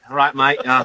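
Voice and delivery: loud, hammed-up Australian bloke accent. To cohosts over his Foster's, loud hammed Australian accent. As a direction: play the Aussie drinker, loudly. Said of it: "That's a great accent there".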